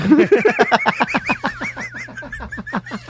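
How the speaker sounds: long high wheezing laugh; wheezy laugh. Real, not acted. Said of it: "You're getting that wheezy laugh".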